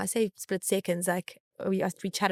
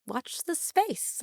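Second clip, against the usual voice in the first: teasing; 7 semitones higher, 6 dB quieter, much slower than usual. Real, not acted. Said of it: "teasing ending".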